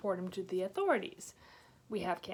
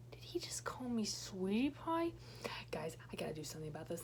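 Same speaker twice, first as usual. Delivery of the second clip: unvoiced, hushed freaked-out whisper; 3 semitones lower, 7 dB quieter than usual. Scared girl whispers to her friends about the creep. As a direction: whisper it so the creep won't hear.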